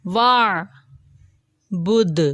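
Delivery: وعبد very slow with a strong bounced final d; strong final qalqala. Shown as a demonstration. Teacher shows word-final bounce: strong d echo. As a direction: draw it out, release the d hard. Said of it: "کیونکہ یہ اینڈ میں تھا".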